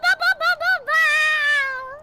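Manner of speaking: very high, loud rapid barking; loud cartoon puppy yaps; playful dog barking. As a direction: yap like an overexcited puppy, drawing out the last bark. Barks along after co-host's barks.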